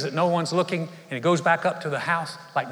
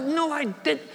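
far higher, startled no I didn't; indignant denial; 12 semitones higher than usual. Retold or imitated. Boy denying his sister's story, indignant. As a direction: protest in disbelief. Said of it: "Michael's like".

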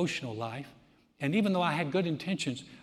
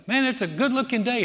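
cheery greeting; 8 semitones higher, 8 dB louder than usual. Retold or imitated. Cheerful son greeting mom.

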